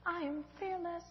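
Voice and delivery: soft, hushed; quietly talking herself into courage; unsure self-talk. Acted. Lost and scared, reassuring herself softly. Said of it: "said to convince herself".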